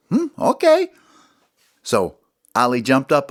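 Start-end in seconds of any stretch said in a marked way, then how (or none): 0.1-0.9 high doubtful little voice; Smalls' voice. Acted. Smalls stepping aside for Ollie, doubtful. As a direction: squeak it out unconvinced. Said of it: "And Smalls goes".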